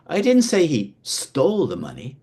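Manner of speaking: "stole" stretched and emphasised; stress on STOLE. Shown as a demonstration. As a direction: lean hard on "stole". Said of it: "by how you say each individual word".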